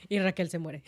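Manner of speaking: laughing. Real, not acted. Listener laughs at the twist.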